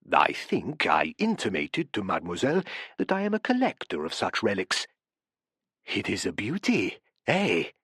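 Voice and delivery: low, soft foreign professor; lightly voiced, relishing his relic; Professor Rigo's voice. A narrator's reading. Professor shows off relic to guests, low and soft.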